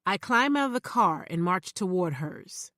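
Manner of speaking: ordinary delivery, nothing marked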